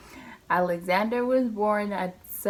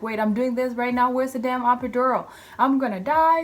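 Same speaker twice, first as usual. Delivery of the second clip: rising panicked shout, louder each line; panicked yell; 7 semitones higher, 7 dB louder than usual. Retold or imitated. Mother in labor yelling at the nurses, panicked shout.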